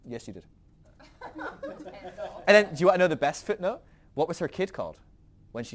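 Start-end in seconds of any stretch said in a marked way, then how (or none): 0.1-0.4 God answering. Retold or imitated. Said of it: "Uh, I didn't laugh". 1.2-2.4 airless high giggle; laughing at the punchline, breathy. Real, not acted.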